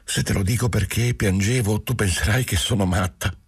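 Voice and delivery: usual speaking voice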